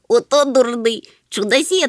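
high, loud little-creature jeer; cheerful mockery. A narrator's reading. Chmykh makes fun of departed Gemul, gleeful. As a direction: jeer merrily, pitch up high. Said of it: "повеселів Чмих".

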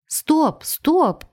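high, drawn-out calls to halt; exclaimed protest. A narrator's reading. Overwhelmed woman halts a stream of questions, exclaiming. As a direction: call a halt, pitched well up.

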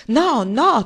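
booming loud no, no; old man roaring his objection; thundering. A narrator's reading. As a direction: thunder the denial at her. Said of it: "tuonò Roaring Abel".

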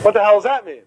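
honest baffled question; puzzled. Shown as a demonstration. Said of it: "what should your response be".

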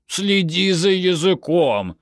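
slow, high-pitched plea; pleading. A narrator's reading. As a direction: beg her to behave, drawing the words out. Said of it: "взмолился Амихай".

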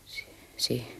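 soft, breathless whisper of dread; stunned whisper. Acted. Woman learns her plot was overheard, whispering in shock. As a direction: caught out, barely a whisper.

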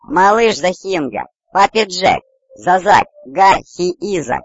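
high squeaky creature chatter; little creature exclaiming. A narrator's reading. Little one talks eagerly to papa Jack, high creature voice. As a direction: pipe each word high, like a tiny creature. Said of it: "воскликнул малыш".

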